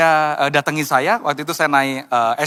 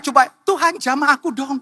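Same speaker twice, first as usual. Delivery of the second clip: mock pleading; 7 semitones higher than usual. Acting out a passive, pleading believer.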